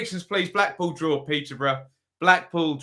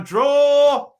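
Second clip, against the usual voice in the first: much higher, louder cry of Draw!; shouted prediction; 12 semitones higher, 8 dB louder than usual. Real, not acted. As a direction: announce the pick with a big shout. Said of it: "right down the middle on this one".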